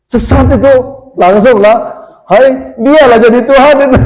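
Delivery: high-pitched mimicked accuser; mock outraged accusation. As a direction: imitate someone jumping to condemn, pitched high. Mimics accusers jumping to condemn him.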